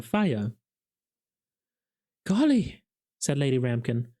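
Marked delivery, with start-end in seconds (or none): 2.3-2.8 high, loud gasp of wonder; amazed by the dragon; breathless golly; awestruck. A narrator's reading. Lady Ramkin awestruck.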